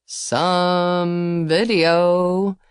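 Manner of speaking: slow, lazy drawl. A narrator's reading. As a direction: stretch the words out lazily. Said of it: "he drawled".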